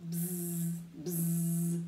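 low, steady buzz; fly buzzing. Acted. Flies circling the smelly pigeon, low droning buzz. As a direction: imitate flies with a droning bzz.

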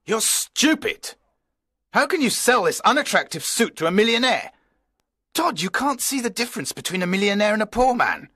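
high, fast furious scolding; angry scolding. A narrator's reading. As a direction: tear into the clerk. Owner scolding his clerk.

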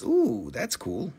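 usual speaking voice

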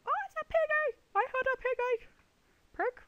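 high, loud delighted squeal; excited squeal. Real, not acted. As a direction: squeal with excitement at spotting a piggy. Spots a pig in the game.

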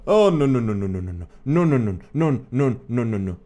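frantic string of no's; panicked. Real, not acted. Panicked in horror game.

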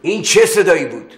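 high, loud insistent question; father pressing the son about the noise; loud demand. Acted. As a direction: demand the answer sharply.

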